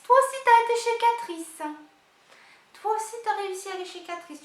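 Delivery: high, loud delighted squeal; excited squeal. Real, not acted. Squealing with delight that her friend shopped at Catrice. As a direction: squeal with glee at the news.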